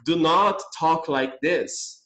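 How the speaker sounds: slow full form. Shown as a demonstration. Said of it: "em vez de eu falar".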